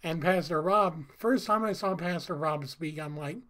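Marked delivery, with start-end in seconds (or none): none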